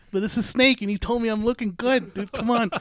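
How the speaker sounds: loud, high, excited retelling; acting out an overexcited fanboy defense; starstruck protest. Retold pleading with girlfriend, starstruck.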